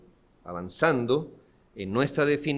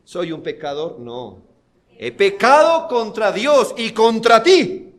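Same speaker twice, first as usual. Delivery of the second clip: loud, higher preaching, pressing each phrase; full-force preaching; 4 semitones higher, 8 dB louder than usual. Acted. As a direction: drive the point home from the pulpit. Preaching the prodigal son. (predicación enérgica y fuerte)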